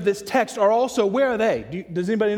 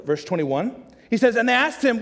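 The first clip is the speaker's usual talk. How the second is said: ordinary delivery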